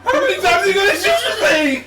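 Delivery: high, loud disbelieving laugh-shout. Real, not acted. Asking friends how many shots the cop fired, laughing shout.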